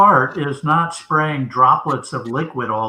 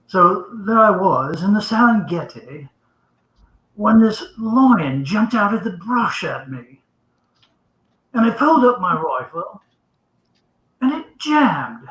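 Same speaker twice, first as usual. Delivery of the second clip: hammed-up English accent, higher pitched, measured storytelling; English gentleman; 5 semitones higher than usual. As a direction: tell it like an English gentleman recounting a safari. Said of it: "these two English gentlemen are talking".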